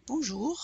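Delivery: rising French "Bonjour", R faint but audible; soft final R. Shown as a demonstration. Teacher repeats, soft-R French "Bonjour". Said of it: "but you still hear it".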